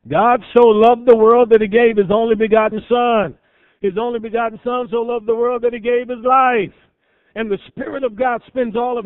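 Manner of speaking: high, loud, ringing pulpit proclamation; full-force preaching. Acted. Preacher proclaiming John 3:16 to the congregation, ringing loud. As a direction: proclaim it to the back pew.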